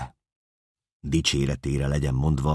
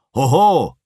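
high, loud shout; much higher, louder cry; shouted halt; 12 semitones higher, 11 dB louder than usual. Acted. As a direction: call out to stop him. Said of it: "kiáltotta".

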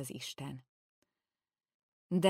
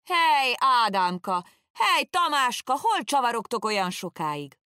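much higher, loud hallooing cry; old servant yelling to prisoners, pretending to call mates; loud shout; 8 semitones higher, 8 dB louder than usual. A narrator's reading. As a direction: holler across the road as if calling lost lads. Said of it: "s azzal elkiáltottam magam".

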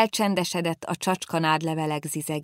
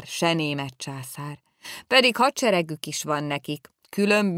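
plain reading voice

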